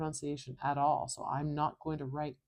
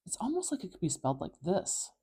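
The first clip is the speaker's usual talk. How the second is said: ordinary delivery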